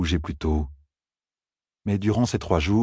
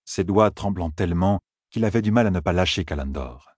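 ordinary delivery, nothing marked

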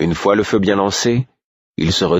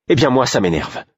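snapping back, higher; exclaiming; 7 semitones higher than usual. A narrator's reading. Molly to Burick, turning to fight, higher exclamation. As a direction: fling it back at him, higher. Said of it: "s'exclama Molly".

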